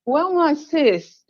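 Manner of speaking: usual speaking voice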